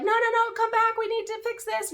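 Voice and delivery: high, loud mock pleading; imitated urgent plea. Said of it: "they're like".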